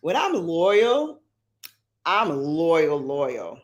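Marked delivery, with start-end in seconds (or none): none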